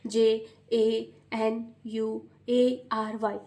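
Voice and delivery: very slow letter by letter spelling; slow child reading. Shown as a demonstration. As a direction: read each letter slowly like a struggling child. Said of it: "बच्चे पढ़ते हैं तो बहुत ही slow पढ़ते हैं".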